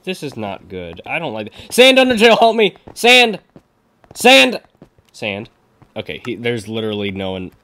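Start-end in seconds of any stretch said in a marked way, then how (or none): 1.7-3.4 high, loud panicked shout; urgent call for help. Real, not acted. Calls teammate for help in game. 4.1-4.6 high, loud shout of SAND; urgent calling out. Real, not acted. Calls teammate again.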